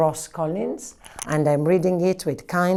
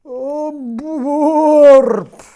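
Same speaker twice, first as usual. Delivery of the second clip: mock burp; 8 semitones higher, 8 dB louder than usual. After lunch.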